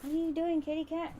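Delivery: high-pitched pet-talk voice; lilting, high voice for a pet; talking to a cat. Real, not acted.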